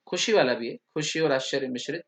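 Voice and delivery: usual speaking voice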